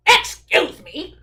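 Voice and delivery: loud, slow protest; indignant shout. Acted. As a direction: call it out as an affronted rabbit. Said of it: "shouted the rabbit".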